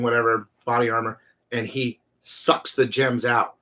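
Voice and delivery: ordinary delivery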